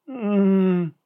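drawn-out plaintive moan; pitiful moan. A narrator's reading. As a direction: moan as if in pain. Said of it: "жалобно простонала".

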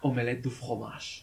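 low, slow seductive French; sexy voice. Shown as a demonstration. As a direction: purr it out slowly, sultry. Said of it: "Tienes que decirlo con más voz sexy".

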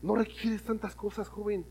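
quiet, slow, earnest; soft appeal. Acted. Preacher quietly telling youth they need little. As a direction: draw them in gently. Preacher softly applying the lesson.

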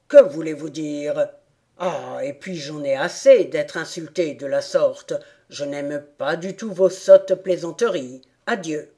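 low, loud, insulted rat; gruff, indignant creature voice; angry rat. A narrator's reading. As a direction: storm off in a huff. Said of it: "absolument fâché cette fois".

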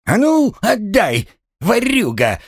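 very high, indignant yell. Acted. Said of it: "заорал Гремлун". (возмущённый крик на высоких тонах)

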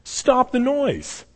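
bemused high plea to stop; high, drawn-out command. Retold or imitated. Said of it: "he's just like".

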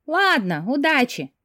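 high, lilting send-off; singsong. A narrator's reading. Bride calls after her departing fiancé, singsong. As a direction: sing it out after someone leaving. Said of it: "пропела ему вслед Карина".

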